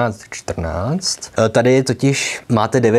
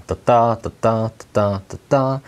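"ta, TA" with the stress on every second "ta"; each "ta" pair with the second "ta" stressed, like a ticking metronome; iamb rhythm. Shown as a demonstration. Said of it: "druhá s přízvukem".

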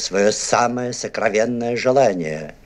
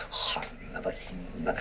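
whispered spell; 4 semitones lower, 10 dB quieter than usual. Acted. Magician casting a spell.

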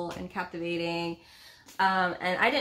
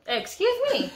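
indignant, pitched up high and louder; playful outrage at her husband; mock offended; 12 semitones higher, 6 dB louder than usual. Real, not acted. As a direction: act insulted, teasing. Wife reacts to "typical woman.

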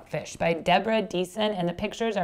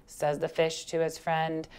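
ordinary delivery

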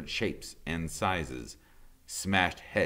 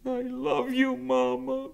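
put-on much higher young goose voice; gosling's goodbye; 6 dB louder than usual. Brightbill to his mother at parting, high and childlike. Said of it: "Brightbill hugged his mother's worn face".

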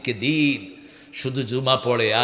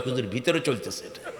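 1 sheepish worshipper; 8 dB quieter than usual. Retold or imitated. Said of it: "কয়".

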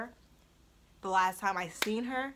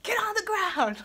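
high, loud command; barked order; 11 semitones higher, 7 dB louder than usual. Retold or imitated. Holding her hair, a high loud yelled command. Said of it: "I'm like".

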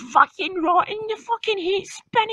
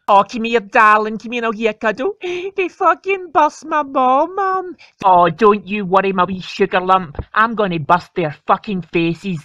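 much lower, louder mum voice, soothing then threatening; comforting mum; 10 semitones lower, 4 dB louder than usual. Acted. Mum comforting her son, low soothing voice. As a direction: mum coddling her boy, then vowing revenge.

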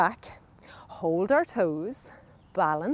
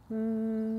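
soft humming on the out-breath; bee breath hum; 4 dB quieter than usual. Shown as a demonstration. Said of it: "make a little humming sound".

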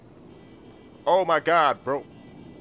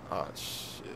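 whispered curse; 12 semitones lower, 14 dB quieter than usual. Acted. Caught without a badge.

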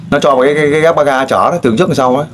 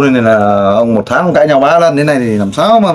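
normal talking voice

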